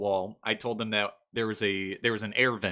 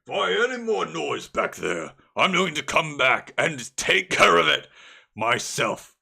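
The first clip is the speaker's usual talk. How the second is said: loud barking orc threat; orc guard; 5 semitones higher, 6 dB louder than usual. Acted. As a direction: bellow at the prisoners like an orc. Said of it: "an orcan voice go".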